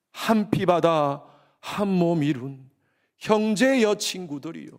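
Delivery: drawn out very slowly, each word weighed; slow solemn. Acted. Pastor to congregation, a slow solemn declaration. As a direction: linger on every word like a vow.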